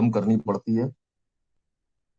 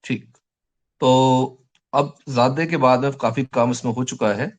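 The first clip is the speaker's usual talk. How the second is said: ordinary delivery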